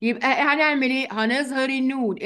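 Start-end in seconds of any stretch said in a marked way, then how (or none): none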